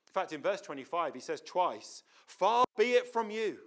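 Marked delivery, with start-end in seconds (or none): 2.3-3.6 raised, forceful plea; strong protest. Retold or imitated. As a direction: push back hard at God. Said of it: "a strong expression he makes".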